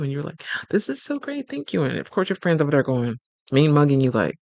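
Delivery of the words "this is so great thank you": high delighted thanks. Retold or imitated. Voicing delighted guest.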